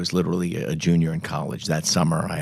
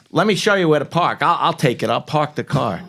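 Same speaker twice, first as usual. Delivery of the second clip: confident friend; 9 semitones higher, 3 dB louder than usual. Retold or imitated. Friend eagerly takes over the car.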